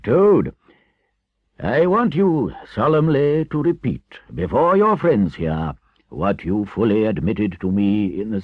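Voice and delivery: loud, commanding elder; solemn, gratified Badger. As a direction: prompt the penitent word by word. Badger demands a solemn repetition.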